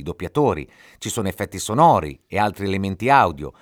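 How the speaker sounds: normal talking voice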